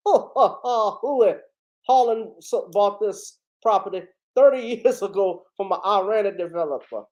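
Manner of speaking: hammed-up Southern private eye accent; Southern-accented detective impression, chuckling through it; Daniel Craig, Kentucky-fried Southern. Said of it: "that's my Daniel Craig impression of Harlan".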